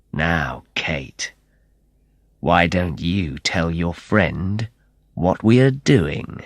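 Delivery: lightly voiced menacing villain; cold menace. Antonio's cold smile, menacing.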